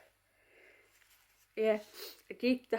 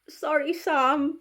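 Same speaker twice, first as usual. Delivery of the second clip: mock apology; 6 semitones higher, 8 dB louder, slower than usual. Playful apology to friend Sam.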